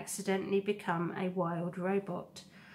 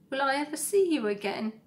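put-on higher, fast, upset child question; tearful young goose; 6 semitones higher, 5 dB louder, faster than usual. Gosling begging his robot mother for reassurance, tearful. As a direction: plead to know if he'll see her.

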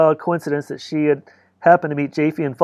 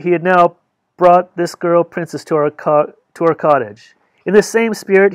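ordinary delivery, nothing marked

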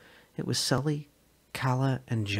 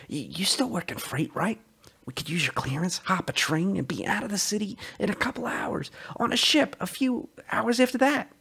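raised, urgent voice of a man desperate to escape; Sully's eager voice; 9 semitones higher than usual. Acted. Sully urging Calla to run away with him, excited and high. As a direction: push the plan on her, breathless with hope.

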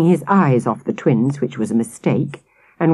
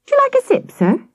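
put-on very high, sugary innocent child voice; butter wouldn't melt; sweetly; faster than usual. Twins offering the soaked policeman a sip, mock sweet. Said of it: "the twins asked sweetly".

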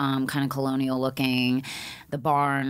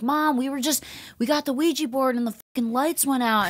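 higher, hurried mock-panicked explaining; flustered kid; 9 semitones higher than usual. Son explaining to his mom after the lights died, mild mock panic. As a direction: blurt it out to mom. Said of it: "he was like".

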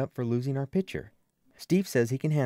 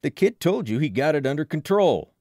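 higher, louder gruff telling-off; loud rebuke; 4 semitones higher, 7 dB louder than usual. A narrator's reading. Frank backing the kid against the man, loud rebuke. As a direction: tell him off loudly for interfering.

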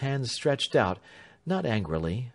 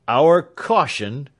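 loud, slow stress on our caution; offended lawyer repeating the accusation; indignant echo; 4 semitones higher, 9 dB louder, much slower than usual. A narrator's reading. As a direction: throw the word back at him. Lawyer bristles at blame.

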